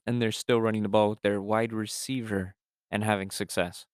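normal talking voice

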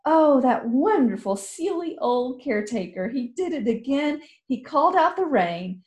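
put-on high, bright-eyed girl's delight; young girl rejoicing in the rain; excited girl. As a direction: gush like a thrilled young girl. Girl character voice.

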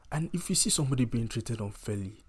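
usual speaking voice

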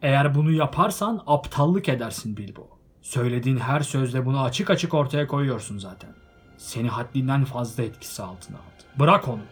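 stern. A narrator's reading. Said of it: "Sert bir edayla konuştu".

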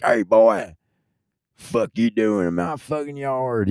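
slow, drawn-out threat from an angry Texan; Texas yard owner. Retold or imitated. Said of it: "them motherfuckers would be like".

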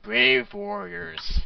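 slow, drawn-out booming greeting. Acted. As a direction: stretch it out like an ancient spirit. Orc skull speaks.

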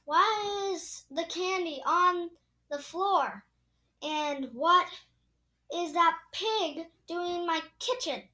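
shouting. A narrator's reading. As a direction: scold loudly with heavy emphasis. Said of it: "shouted Eugenia".

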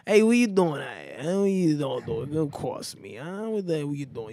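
hammed-up Italian-American wise guy; Italian accent. Shown as a demonstration. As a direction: do a mock Italian tough guy. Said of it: "it means he talks like this".

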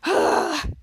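short, voiceless sigh; acted sigh. Retelling two characters sighing together, mock sigh. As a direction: let out an audible sigh. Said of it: "Charlotte and Captain Man both sighed".

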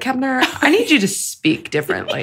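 an irritated plea, imitated with amusement. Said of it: "she's just so irritated".

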